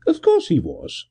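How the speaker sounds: high airy cheerful toss; breezily amused gentleman; light high cheerful. A narrator's reading. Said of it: "Gervase answered cheerfully".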